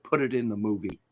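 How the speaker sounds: ordinary talk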